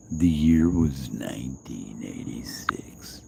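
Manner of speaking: slow whispered movie-trailer intro; hushed, slow storyteller whisper; dramatic whisper. Pretend. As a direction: breathe it like a flashback narrator. First take of intro.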